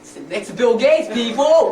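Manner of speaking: loud and rising, hyping the crowd; host hype shout. Pretend. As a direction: call it out to the audience. Talk show host hyping crowd.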